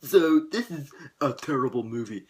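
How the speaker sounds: mocking whiner. Mocks people who trash fantasy films, snobbish put-on voice. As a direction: sneer it like a snob. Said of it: "They're like".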